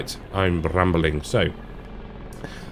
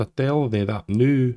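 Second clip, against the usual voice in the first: put-on Scottish accent; 4 semitones higher than usual. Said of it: "I went into a Scottish accent then".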